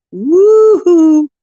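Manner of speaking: a high, loud whoop; excited whoop. Real, not acted. Cheering as James's card appears, excited whoop.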